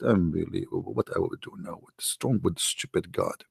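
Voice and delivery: low, soft grumbling; hushed mock despair; muttered despair. [name] muttering, hitting his head.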